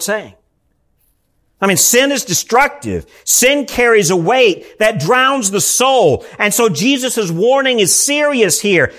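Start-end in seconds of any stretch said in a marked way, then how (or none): none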